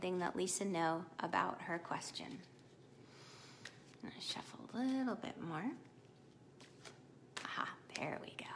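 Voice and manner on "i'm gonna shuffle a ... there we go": murmured under the breath while shuffling cards; whisper to herself. Real, not acted. Reader whispers to herself while shuffling the deck, hushed. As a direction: hush it, talking to yourself.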